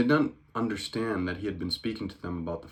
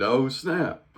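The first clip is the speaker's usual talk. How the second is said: excited oh snap; 6 semitones higher, 5 dB louder than usual. Real, not acted. Reacting to Jesus' retort.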